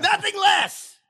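high, far-carrying theatrical shout; Henry V battle cry. Pretend. Mock-heroic actor rallying friends to battle, big stage shout. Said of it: "I don't know if Henry Five is a".

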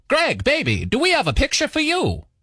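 put-on high, old Hollywood producer voice; 1940s studio mogul impression. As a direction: toss it off like a 1940s studio boss. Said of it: "are you calling from a nineteen forties film studio?".

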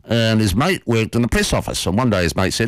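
normal talking voice

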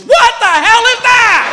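high, shouted mock-wife outrage; appalled wife. Wife seeing her husband step out of closet, appalled shout. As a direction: shriek it in imitated horror.

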